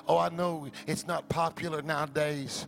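ordinary talk, nothing marked

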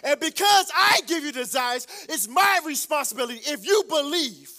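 loud, rising preacher cadence, hammering each phrase; preaching at full force. Acted. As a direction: preach it to the back row.

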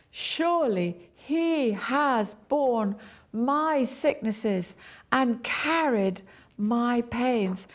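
slow, every word weighted; solemn, deliberate full read; slow solemn stress. Shown as a demonstration. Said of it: "emphasize one word at a time".

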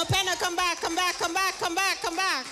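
ordinary delivery

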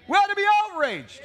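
outraged preacher's cry; preached shout. Acted. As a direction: hammer it home to the crowd. Repeats line, louder second time.

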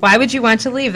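ordinary talk, nothing marked

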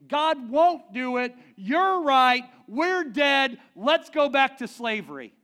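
mock defeatist leader. Imagined leader urging return to slavery, loud despair. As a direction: wail it like a hopeless leader. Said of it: "courageous to say".

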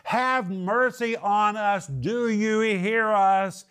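high, drawn-out pleading call; mock yelling plea. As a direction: slowly call out as if to someone not listening. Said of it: "They're yelling and screaming".

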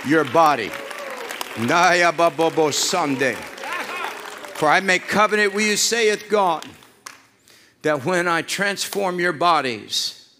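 fiery preaching. Real, not acted. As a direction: preach it at full force. Preaching builds to full force.

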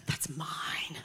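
whispered, low "That's mine"; mock possessive. Believer owning their unbelief, in a mock whisper. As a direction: clutch it like a secret treasure.

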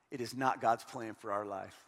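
"It is not God's plan for our life" dropped low and soft, deliberate; quiet emphatic. Acted. Preacher to a hurting congregation, low and soft.

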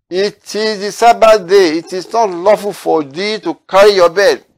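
loud, slow stern scolding; stern rebuke. Retold or imitated. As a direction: bark it out as the strict elders.

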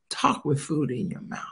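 soft, dropped-down reveal; hushed punchline. Acted. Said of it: "So guess what?".

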